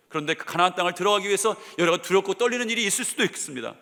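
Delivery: normal talking voice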